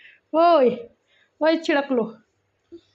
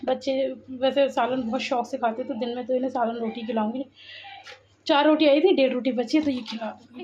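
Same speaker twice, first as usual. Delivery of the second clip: usual speaking voice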